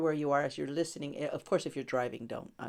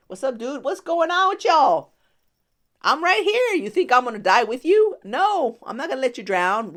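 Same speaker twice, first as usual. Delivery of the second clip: loud, high, laid-back dude Jesus; casual Jesus paraphrase; 7 semitones higher, 10 dB louder than usual. Retold or imitated. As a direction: play it cool and teasing. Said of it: "my paraphrasing".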